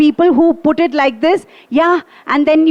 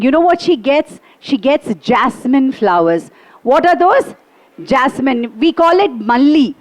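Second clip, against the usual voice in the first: ordinary delivery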